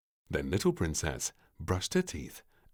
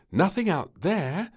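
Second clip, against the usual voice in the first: queen; 12 semitones higher, 9 dB louder than usual. Acted. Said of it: "said the Queen".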